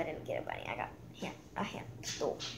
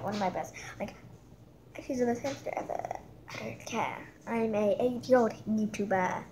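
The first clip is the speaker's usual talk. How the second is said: ordinary talk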